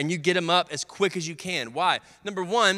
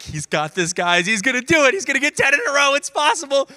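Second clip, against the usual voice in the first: building from plain to much higher and louder; excited teammates; 8 semitones higher, 4 dB louder than usual. Retold or imitated. Teammates watching the shots, rising excited chatter. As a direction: get more and more hyped as you go. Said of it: "They're like".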